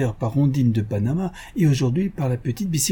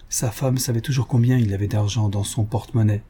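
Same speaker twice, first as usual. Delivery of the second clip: plain reading voice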